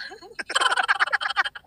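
long high laugh. Real, not acted. Laughs at the creepy voice.

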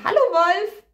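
put-on high, bright girl's hello; a little girl calling hello; greeting the wolf. A narrator's reading. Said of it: "Und Rotkäppchen grüßte".

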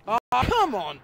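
loud, high exasperated shout; frustrated yell. Real, not acted. Player frustrated at game.

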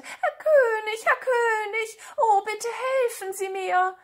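high, drawn-out tearful plea; wailing lament. Acted. Cat begs the king for help, high tearful wailing.

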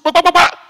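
gunshot sounds. Retold or imitated. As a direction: fire off the shots with your mouth. Imitated gunshots.

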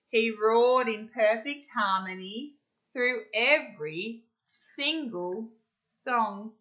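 put-on lively read-aloud for little kids, bright and bouncy; story narration. Teacher reading a rhyming picture book to children, animated.

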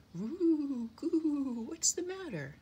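high, long-drawn cooing; gentle dove. A narrator's reading. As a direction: coo it out, high and full, then ask kindly. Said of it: "asked a gentle voice".

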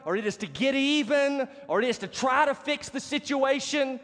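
high-pitched, driving sermon cadence; emphatic preaching. Real, not acted. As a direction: preach each item at the congregation with force. Preacher builds list at raised pitch.

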